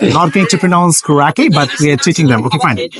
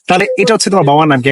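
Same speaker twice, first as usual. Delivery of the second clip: ordinary talk, nothing marked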